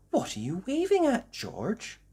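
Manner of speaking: high curious mum; Mummy Pig voice. Acted. Mummy Pig asking George, high curious voice. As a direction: ask gently and curiously. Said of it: "asked Mummy Pig".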